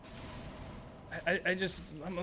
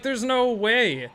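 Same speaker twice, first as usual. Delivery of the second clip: mock disbelief; 8 semitones higher, 6 dB louder, slower than usual. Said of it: "I'm like".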